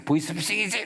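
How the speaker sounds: teasing accusation; accusing. Retold or imitated. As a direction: call the caller a scammer. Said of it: "막 그래".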